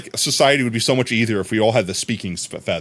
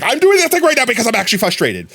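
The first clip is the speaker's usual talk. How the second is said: high, louder hammed-up frustration; mock frustrated outburst; 10 semitones higher, 4 dB louder than usual. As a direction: let it out in a comic burst. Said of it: "fake being angry. You're frustrated".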